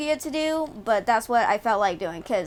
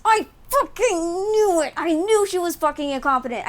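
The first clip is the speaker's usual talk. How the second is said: gleeful told-you-so, much higher; vindicated outburst; 5 semitones higher than usual. Real, not acted. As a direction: crow it out, proven right. Vindicated about incompetent governor.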